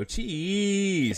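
high, drawn-out "cheese"; imitated movie catchphrase. Quoting the film to his wife, drawn out. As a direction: stretch "cheese" out high, as a running gag. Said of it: "that's one of the things I do".

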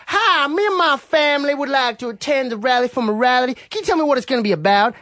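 a light faked Arkansas accent on the phone; fake accent. Calling the KKK pastor in a light fake Arkansas accent. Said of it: "he might have believed your stupid accent".

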